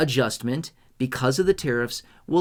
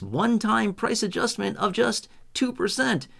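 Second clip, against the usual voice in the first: infomercial voice; 7 semitones higher than usual. Pretend. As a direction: sell it like a TV infomercial. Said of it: "Do I sound like an infomercial yet?".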